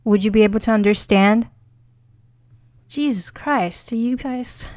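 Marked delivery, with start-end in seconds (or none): 2.9-4.6 slow, soft, exasperated sigh of a line; mock exasperation. Teacher sighing at students who want English, exasperated. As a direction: sound fed up with the students, drawn out.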